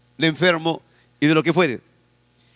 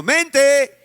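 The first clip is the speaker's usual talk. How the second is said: drawn-out call; 8 semitones higher, 3 dB louder than usual. Shown as a demonstration. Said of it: "Repite conmigo".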